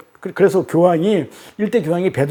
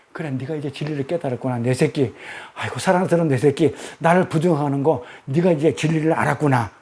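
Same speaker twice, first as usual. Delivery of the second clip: tender Jesus; 4 semitones lower than usual. Acted. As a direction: murmur it lovingly to a beloved disciple. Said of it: "사랑스럽게 봤다니까요". (사랑스러운 제자에게 다정하게 속삭이듯)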